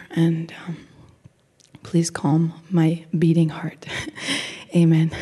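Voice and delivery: low, slow prayer fading into a whisper; hushed prayer, partly whispered; nervous prayer. Real, not acted. Opening prayer before speaking.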